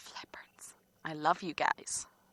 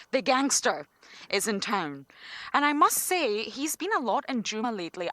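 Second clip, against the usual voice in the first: excited; 4 semitones higher, 6 dB louder than usual. Real, not acted. Excited guide spots leopard.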